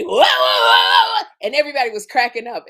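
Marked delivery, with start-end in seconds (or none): 0.0-1.3 slow, loud mock exclamation; big hammed-up whoa. Retelling Kim's rehearsal reaction, loud slow hammed-up whoa. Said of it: "Kim would be".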